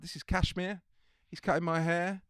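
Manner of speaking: breezy host voice; imitated Bailey. Imitating Bailey introducing his hairdresser, cheery.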